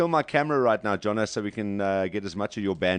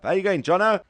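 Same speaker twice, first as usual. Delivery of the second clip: raised, high greeting call down the line; 8 semitones higher, 6 dB louder than usual. Real, not acted. Host calls loudly over the Skype line. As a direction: call out to Jono on the screen.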